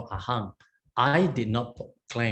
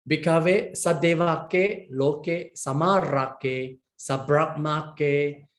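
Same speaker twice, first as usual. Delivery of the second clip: slow, higher chant-like reading of the Pali; Pali recitation; 3 semitones higher, slower than usual. Shown as a demonstration. Teacher reading the sutta aloud to students, slow measured chant. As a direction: intone each word slowly, like reciting scripture.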